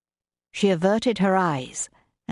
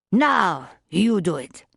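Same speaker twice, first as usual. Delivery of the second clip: put-on French accent; slower than usual. A narrator's reading. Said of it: "with that strong French accent".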